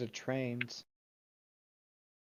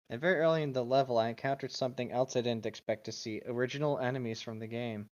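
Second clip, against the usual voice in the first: reading aloud. Acted. Reading game text aloud.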